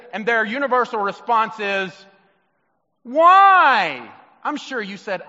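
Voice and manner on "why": put-on high, loud whining why from a child; mock a kid whining back at a parent; bratty child whining why; whiny kid. Child's retort imitated by preacher.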